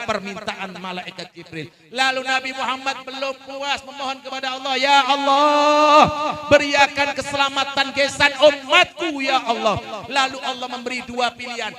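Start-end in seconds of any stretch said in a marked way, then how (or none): 4.8-9.8 louder, earnest plea for his people; Prophet pleading. Acted. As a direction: call out to God, begging. (permohonan Nabi yang lantang dan sungguh-sungguh)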